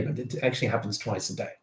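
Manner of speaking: usual speaking voice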